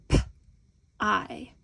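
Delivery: P-I as a puffed "p", a gap, then a long "eye"; sounded out. Shown as a demonstration. Teacher sounding out the first syllable, sound by sound. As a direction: separate P-I into its two sounds, not letter names. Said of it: "How do we sound that out?".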